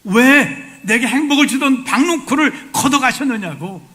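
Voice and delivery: loud, high, indignant complaint with a laugh; angry protest. Acted. The angry prophet Jonah shouting his complaint at God. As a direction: throw the accusation up at God. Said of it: "지금 화를 내고 있습니다".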